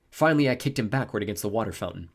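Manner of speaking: ordinary delivery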